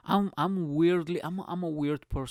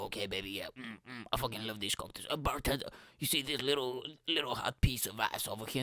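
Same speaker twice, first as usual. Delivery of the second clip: a touch of a creepy pickup artist; sleazy guy; 5 dB quieter than usual. Retold or imitated. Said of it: "like a sleazy guy".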